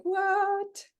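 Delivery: mock shock. Host voicing her shock.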